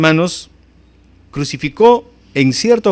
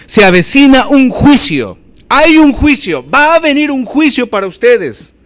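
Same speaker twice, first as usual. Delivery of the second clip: proclaiming judgment; 8 semitones higher, 5 dB louder than usual. Acted. Preacher voicing Jesus warning the crowd of judgment, loud proclamation. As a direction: thunder it out like a prophet's warning.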